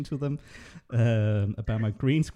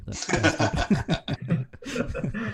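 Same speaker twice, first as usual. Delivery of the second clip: laughing mock outrage; 6 semitones higher, much slower than usual. Mock grudge over a stolen win.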